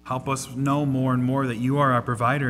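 closing prayer. Real, not acted.

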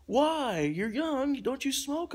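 high mock-baffled questioning; imitated disbelief. Stranger offered smokes and was refused, imitated high disbelief.

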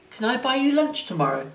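tentative, lifted voice; hopeful asking. A narrator's reading. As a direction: ask her out hopefully. Boy shyly asks girl out.

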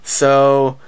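ordinary talk

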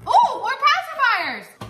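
high, loud, stretched-out squeal; excited delight. Real, not acted. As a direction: cry out over a new present. Delighted at more baby gifts.

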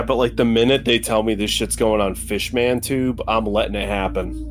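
ordinary talk, nothing marked

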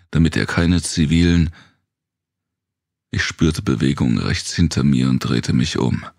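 usual speaking voice

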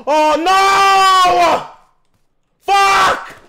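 loud, high cry of dismay; shocked shout. Real, not acted. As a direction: yell it out as the round is lost. Squad wiped, sudden dismay.

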